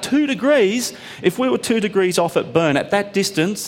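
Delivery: high, insistent and emphatic; indignant explaining. Retold or imitated. Engineer lecturing the passenger, high heated insistence. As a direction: drive the point home, worked up. The guy nearly spat blood.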